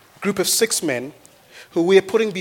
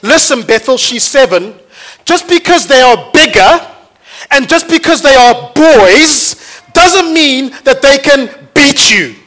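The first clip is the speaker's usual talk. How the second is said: loud, rousing encouragement to his daughter; dad rallying his little girl, loud and fierce; fired-up dad pep talk; 7 semitones higher, 10 dB louder than usual. Retold or imitated. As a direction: pump her up like a coach. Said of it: "I wanted to put inside her".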